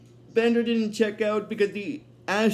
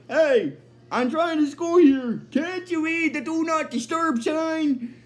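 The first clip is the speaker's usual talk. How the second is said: annoyed raised-pitch call; Bender; 7 semitones higher than usual. Retold or imitated. Robot interrupted by a knock at the door, annoyed higher voice. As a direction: call out in mock irritation, drawing out the last words. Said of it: "he hears a knock on the door and he's like".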